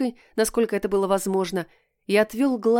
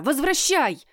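higher, more insistent repeat; angrier demand; 7 semitones higher than usual. A narrator's reading. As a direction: push the demand harder.

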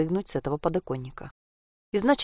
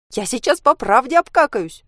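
put-on high, loud childish whimper; tearful wail; 6 semitones higher, 6 dB louder than usual. A narrator's reading. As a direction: wail as if starting to cry. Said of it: "заплакала Анька". (плаксиво, высоко и громко)

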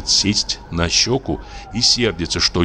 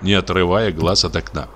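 usual speaking voice

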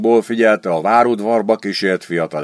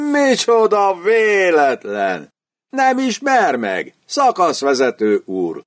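slow, higher, overly friendly greeting; mock friendly; 8 semitones higher, slower than usual. Student greets the sergeant with slow, mock friendliness. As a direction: play the long-lost friend, slow and bright in pitch. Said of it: "rázogatta meg kedvesen".

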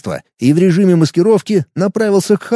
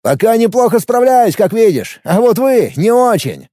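mocking retort; 7 semitones higher than usual. A narrator's reading. Alex mocks the enemy.

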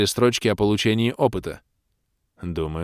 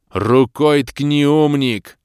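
loud, slow, higher and strained; near shout; 6 semitones higher, 7 dB louder, much slower than usual. A narrator's reading. Ros losing patience with the elf, almost shouting. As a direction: nearly yell it in frustration. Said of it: "Едва не сорвался я на крик".

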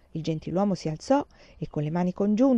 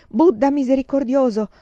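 a loud, higher cry to Buddha; cried out prayer; 5 semitones higher, 6 dB louder than usual. A narrator's reading. A man kneeling beside her, crying out the prayer. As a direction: call the prayer out urgently. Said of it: "gridò senza una pausa".

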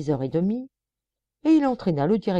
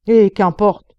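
loud, sharp snap; irritated official; angry retort; 3 semitones higher, 10 dB louder, slower than usual. A narrator's reading. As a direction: dismiss the objection. Director retorts angrily.

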